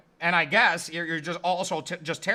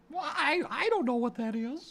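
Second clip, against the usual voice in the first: put-on child voice; 4 semitones higher, 3 dB quieter than usual. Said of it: "Child number two".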